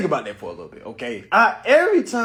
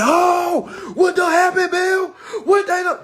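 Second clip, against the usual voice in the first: much higher and louder taunt; gloating shout; 12 semitones higher, 6 dB louder, slower than usual. Retold or imitated. Taunting the cop who let him go, shouting. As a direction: rub it in his face.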